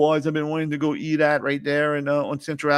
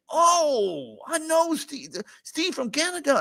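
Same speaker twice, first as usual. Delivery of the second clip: much higher, lit-up voice; retelling his delighted reaction to the bartender, high and excited; excited recognition; 12 semitones higher, slower than usual. Retold or imitated. Telling Steve how he lit up at the bar, high-pitched and excited.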